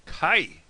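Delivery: "Kai" loud and high, rhyming with eye; Ulster pronunciation. Shown as a demonstration. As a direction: punch out "Kai" with a gliding vowel. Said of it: "how they say it in Ulster".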